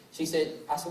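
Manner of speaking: ordinary delivery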